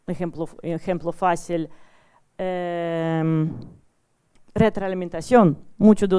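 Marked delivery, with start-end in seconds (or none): none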